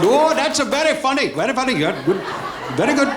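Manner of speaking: high, sing-song mock praise; mock approving heckler. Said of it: "the guy in the back going".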